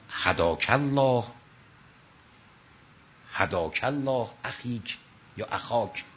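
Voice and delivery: low, slow and gentle, no anger; lightly voiced serene imam, unhurried; calm reply. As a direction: answer softly, like a patient guide.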